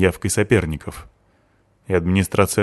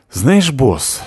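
higher-pitched, angry retort; sharp and angry; 5 semitones higher than usual. A narrator's reading. Girl answers her boss's remarks on her looks, sharp and angry. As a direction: round on the boss sharply. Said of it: "ответила швабра резко и зло".

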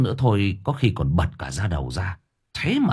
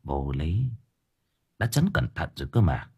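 softer, musing to himself; inner thought; 3 semitones lower, 6 dB quieter than usual. Acted. Master puzzles over his failed ritual, thinking to himself. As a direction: think aloud under the breath. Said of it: "Cậu thầm nghĩ".

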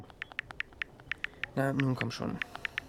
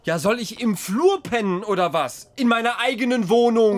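louder and higher with each question; rising anger; 7 semitones higher, 6 dB louder than usual. Acted. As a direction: push back, getting heated. Arguing with partner about the noise. (zunehmend gereizt und lauter)

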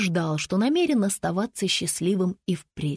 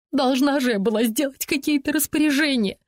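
high-pitched defensive protest; self-justifying; 6 semitones higher than usual. A narrator's reading. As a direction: justify yourself in a raised voice. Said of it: "оправдывается Сисси". (оправдываясь, высоким голосом)

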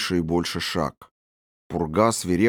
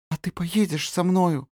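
timid trembling voice; 10 semitones higher than usual. Acted. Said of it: "робко ... трепещущий, охрипший голосок".

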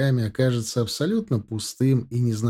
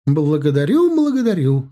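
grand thank-you, rising higher; bowing performer; 4 semitones higher, 6 dB louder than usual. Acted. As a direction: thank the crowd like a diva. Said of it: "раскланялась Тесс".

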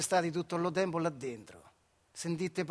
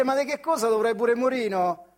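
higher, louder scornful imitation; mocking the bosses' reply; 5 semitones higher, 6 dB louder than usual. Bosses brushing off the sick worker, loud mocking imitation. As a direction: throw their dismissive words back, mocking. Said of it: "Te dice".

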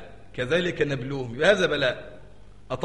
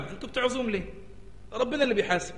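mocking high objection; 6 semitones higher, 3 dB quieter than usual.

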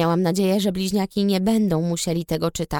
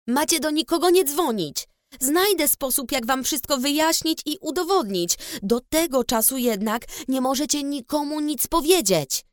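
a firm, lightly raised command; shouted; 6 semitones higher than usual. A narrator's reading. Ann forbidding the twins to call anyone, mildly raised. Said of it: "krzyknęłam".

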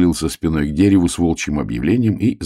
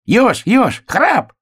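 очень высокий отрывистый зов; выкрикивай тоненько и коротко; крик зайца; much slower than usual. A narrator's reading. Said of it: "крикнул заяц". (пискливый крик зайца)

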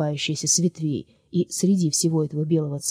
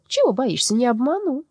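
a wheedling market woman, lightly raised; sly trader voice; 8 semitones higher, 3 dB louder than usual. Acted. Soul coaxing Lyolya to sell her heart, wheedling. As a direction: coax the girl into the deal.

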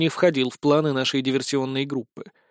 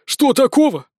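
higher, louder indignant echo with stress on "такого"; frowning echo; 8 semitones higher, 5 dB louder than usual. A narrator's reading. Fairy boy echoing Bezos, indignant and higher. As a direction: throw it back at her, stressing "такого". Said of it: "нахмурился мальчик-волшебница-фея".

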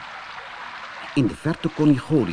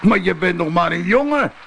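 scornful giant shout; 6 semitones higher than usual. A narrator's reading. Goliath scoffs at the shepherd boy, mocking giant shout. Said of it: "riep de reus".

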